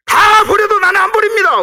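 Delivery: high, loud defiant protest; Peter's defiant shout. Acted. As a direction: shout it like bold Peter swearing loyalty. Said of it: "베드로가". (베드로가 큰 소리로 맹세하듯)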